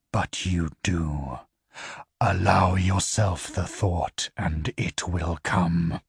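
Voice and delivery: low, measured voice of an alien being; low infernal voice. Acted. The infernal questioning the Empire's envoys, low and measured. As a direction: speak down low like something not human. Infernal coaxes the other half.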